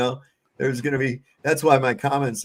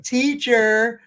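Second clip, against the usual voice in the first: high whiny tattle; imitating a child telling on a sibling; tattling kid; 8 semitones higher, 3 dB louder, slower than usual.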